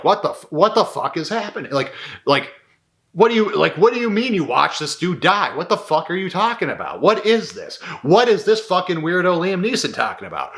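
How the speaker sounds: mock panicked socialite demanding answers; bewildered freakout. Imagined old woman freaking out.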